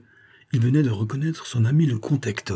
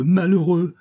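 frantic terror rising; 8 semitones higher, 3 dB louder, slower than usual. A narrator's reading. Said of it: "répéta-t-elle avec le délire de l'épouvante".

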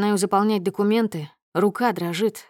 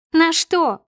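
loud, high-pitched indignant shout; explosive outburst; 9 semitones higher, 6 dB louder than usual. A narrator's reading. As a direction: explode at him in front of everyone. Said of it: "Взрывается Снежана так громко". (возмущённый громкий выкрик)

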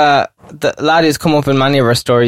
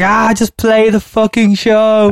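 higher, slow, drawn-out exasperated plea; a stretched, fed-up groan at the bandmate; exasperated mock urging; 7 semitones higher, much slower than usual. Telling the bandmate at the gig to just play, drawn out and exasperated. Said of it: "I was like".

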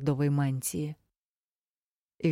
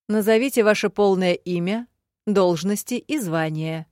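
loud, raised formal request; official tone; 4 semitones higher, 6 dB louder than usual. A narrator's reading. Secretary asks the duelist for name and titles, officially. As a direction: project it like a ceremonial official. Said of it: "официальным тоном попросил секретарь".

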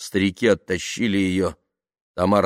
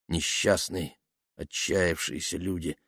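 inner thought; 7 dB quieter, slower than usual. A narrator's reading. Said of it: "думал он".

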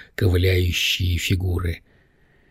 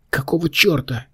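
raised, indignant exclamation; mild outraged cry at a higher pitch; 7 semitones higher than usual. A narrator's reading. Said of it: "вскричала Лаура".

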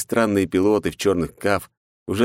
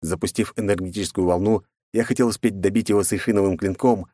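ordinary delivery, nothing marked

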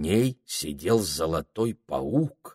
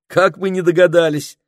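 loud, high-pitched exclamation; exclaiming; 11 semitones higher, 10 dB louder than usual. A narrator's reading. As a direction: call it out excitedly. Children exclaim on discovering a parrot.

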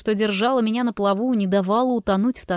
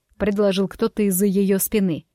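ordinary delivery

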